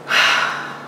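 sigh. A narrator's reading.

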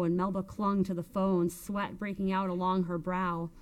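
ordinary delivery, nothing marked

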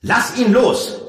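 high, loud, slow command; worked up. A narrator's reading. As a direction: shout the order angrily. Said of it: "sagte Eragon aufgebracht".

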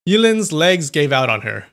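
usual speaking voice

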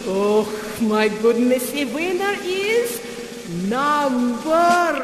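grand ballet teacher announcing a winner; theatrical ballet instructor. Acted. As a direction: play a gushing prima ballerina instructor.

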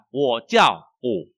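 loud, slow Chinese model sentence; model reading. Shown as a demonstration. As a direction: project each syllable slowly for the class. (đọc mẫu to, chậm, rõ từng tiếng)

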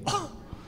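high, soft theatrical gasp; mock-shocked gasp. Actor answering a fan's question, mock-shocked gasp.

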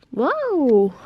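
loud, delighted whoa; surprised. Real, not acted. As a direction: gasp it out in delight. The lights turn on.

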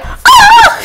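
sharp high shriek; sudden scream. Real, not acted. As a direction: let out a sudden high yelp.

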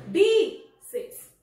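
bíceps with BI very loud and high; stress on first syllable. Shown as a demonstration. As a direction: punch BI hard, let ceps fall away in bíceps. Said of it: "Nós temos o bi como a sílaba tônica".

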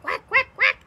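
high, loud little quacks; ducklings quacking. Acted. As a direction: chirp it like tiny ducklings. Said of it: "says the ducklings".

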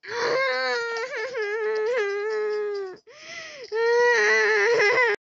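loud, high sobbing laughter; real distress turning to laughter; crying laugh. Real, not acted. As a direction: laugh and wail in despair. Breakdown while losing.

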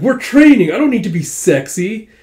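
loud, high exasperated comeback; hammed-up outraged objection; indignant retort. Princess snapping back at his unsexy jibe, indignant retort.

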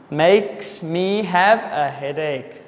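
ordinary delivery

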